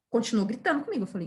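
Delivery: ordinary delivery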